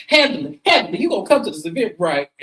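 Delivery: high, fast, pushy invitation; Contessa voice. Retold or imitated. Contessa pressing Heavenly to come, high and fast. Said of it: "here come Contessa".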